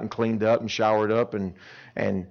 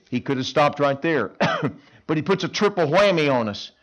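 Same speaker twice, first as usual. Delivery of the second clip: ordinary talk, nothing marked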